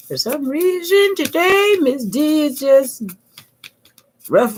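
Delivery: high-pitched, playful announcement. Real, not acted. Crafter confides her mood to viewers, playful high voice.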